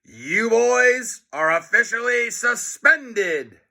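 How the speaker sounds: high, slow gloating principal voice; drawn-out triumphant announcement; gleeful principal. A narrator's reading. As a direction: savor the bad news with delight. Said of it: "Mister Krupp shouted with delight".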